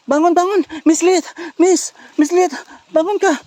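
high, loud urgent calls; urgent rousing. Real, not acted. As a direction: try to wake someone who's passed out. Rousing a woman lying unconscious.

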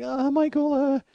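high, drawn-out mock appeal; uneasy wife. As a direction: imitate a wife calling for rescue. Wife under stripper pile appeals to him.

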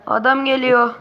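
loud urgent warning. Real, not acted. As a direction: alert your teammates he's coming. Warning of a player coming.

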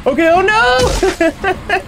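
high, drawn-out cry of dismay; stretched, high-pitched "oh no"; alarmed wail. Real, not acted. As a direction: wail it as you fall. Failing a jump in game.

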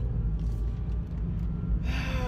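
heavy exasperated sigh; big frustrated sigh; loud sigh. Real, not acted. Dreads new ID photo.

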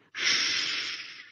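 long soft hiss for silence; hushing hiss; hissed shush. A narrator's reading. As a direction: shush them quietly. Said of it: "hissed Mound Shroud".

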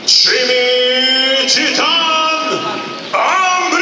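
long drawn-out ring announcer shout; announcer call-out. Real, not acted. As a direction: stretch the name out and boom it. Ring announcer calls fighter name.

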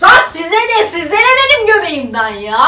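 loud, snapping back at critics; loud pretend fight with the commenters; mock angry rant. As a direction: tell the commenters off, hammed up. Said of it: "Kavga mı ediyor kendi kendine".

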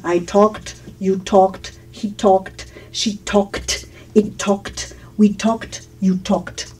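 "talked" as "tokt", the L left silent. Shown as a demonstration. Teacher conjugating the past for students, "talked" with silent L. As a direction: softly drill each "talked" with no L and a final T. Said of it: "pero no se pronuncia".

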